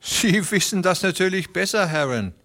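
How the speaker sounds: high, fawning voice, quick in the middle; fat Beermann greeting. A narrator's reading. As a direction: Beermann sucks up to the ranchers, high and fast. Beermann voice again.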